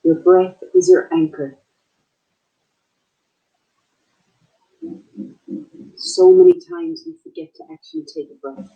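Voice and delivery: unhurried meditation guide, long pauses between phrases; short, spaced-out calming instructions; guided meditation. Acted. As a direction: guide listeners gently into their breath.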